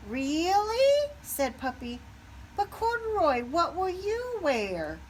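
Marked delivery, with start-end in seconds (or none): 0.1-1.1 high, loud delighted question; puppy's eager disbelief; hopeful surprise. Acted. 2.6-5.0 a small friend's worry; worried Puppy. Acted.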